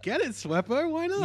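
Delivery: high, hammed-up hype; mock cheering. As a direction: cheer her on in a rising, high-pitched voice. Acted cheer for the contestant.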